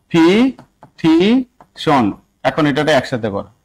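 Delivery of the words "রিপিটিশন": রিপিটিশন stretched out very slowly, syllable by syllable; broken into syllables. Shown as a demonstration. Teacher breaking the word down for the class, very slowly. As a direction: drag রিপিটিশন out, one syllable at a time. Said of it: "তাহলে উনি আরো ভাঙছে".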